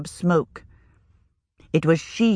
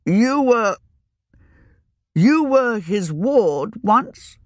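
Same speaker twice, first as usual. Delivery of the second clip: slow, gentle questioning; curious older wizard; 5 semitones higher, slower than usual. Acted. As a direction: ask her kindly.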